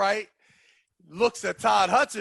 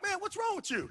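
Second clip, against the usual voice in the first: very high, softer incredulous question; retold exasperation; 13 semitones higher, 9 dB quieter than usual. Replying to the loser, high and exasperated. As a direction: imitate a baffled, pitched-up reproach.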